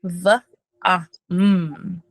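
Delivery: "V" buzzed hard, then "ah" and "mm" held apart; over enunciating. Shown as a demonstration. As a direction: lean on the "V", open "ah", closed hum "mm". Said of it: "remember to pronounce the V".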